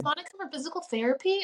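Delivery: high, brisk receptionist-style call-out; professional phone voice. Retold or imitated. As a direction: put on a polite office voice. Imitates convincing phone voice.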